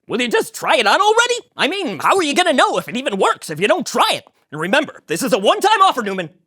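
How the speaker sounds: high, loud fast Kramer; pushy Kramer. Acted. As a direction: pressure him to try it. Kramer pushing Newman.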